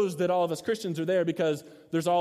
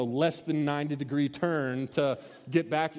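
ordinary talk, nothing marked

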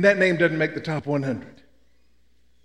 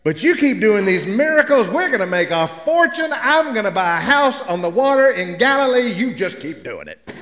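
greedy Matthew; 3 semitones higher than usual. Retold or imitated. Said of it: "Matthew sitting there going".

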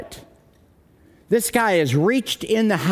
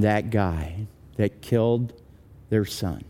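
sombre storytelling; 8 semitones lower than usual. Acted. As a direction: let each phrase sink, mourning the son. Sombre telling of the son's death.

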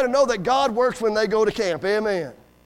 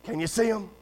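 retold whisper; 3 semitones lower, 7 dB quieter than usual. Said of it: "I hear him whispering".

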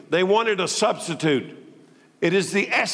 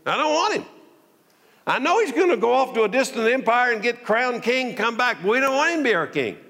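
defiant crowd rejecting him; 8 semitones higher than usual. Acted. Citizens rejecting the nobleman.

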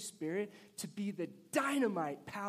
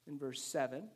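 ordinary talk

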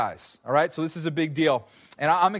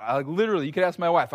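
usual speaking voice

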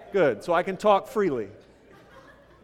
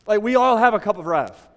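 louder preaching push; 3 semitones higher, 8 dB louder than usual. Real, not acted. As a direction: say it again, louder and harder. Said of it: "repeats line with rising force".

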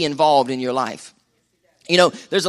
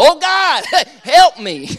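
high, loud hammed-up wail for help; playful cry to God, breaking into a laugh; mock crying out; 12 semitones higher, 6 dB louder, much slower than usual. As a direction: wail it jokingly, far above normal pitch. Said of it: "you too will be crying out".

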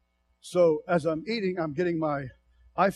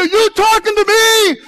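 high, loud, shouted challenge; booming voice of God; 13 semitones higher, 9 dB louder than usual. Retold or imitated. Preacher voicing God to the church, booming. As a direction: call out like God answering. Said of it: "God starts saying to you".

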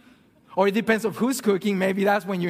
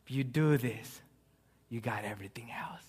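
much softer, whispered; 9 semitones lower, 9 dB quieter than usual. Real, not acted. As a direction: let it fall to a whisper. Preacher drops to a whisper.

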